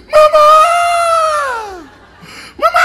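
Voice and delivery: put-on high, loud child's scream; crying for mama. Toddler locked in her room screaming for her mother. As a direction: shriek for your mother.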